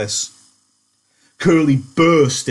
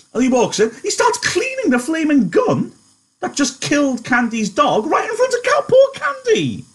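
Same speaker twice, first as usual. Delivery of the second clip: much higher, rising disbelief; high, indignant, stretched-out outrage; 14 semitones higher than usual. Real, not acted. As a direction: climb higher in outrage over poor Candy. Teacher outraged at Carlson.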